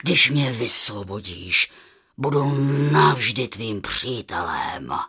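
very low, measured lion voice; solemn deep-voiced lion; earnest lion. Voices the lion promising friendship.

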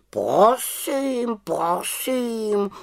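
high, drawn-out huffy voice; offended raven. A narrator's reading. Raven takes offence at the little witch's retort, huffily. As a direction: sound huffy, stretching each word up high. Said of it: "urazil se Abraxas".

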